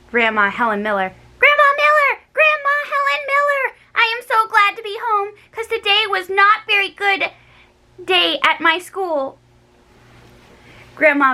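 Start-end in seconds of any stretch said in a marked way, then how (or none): 1.4-9.4 calling out. A narrator's reading. Girl running home, hollering for Grandma Miller. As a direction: yell through the house for Grandma.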